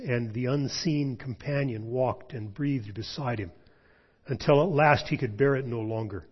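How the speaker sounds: plain reading voice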